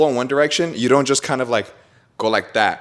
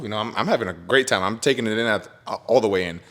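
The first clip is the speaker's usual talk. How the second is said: ordinary delivery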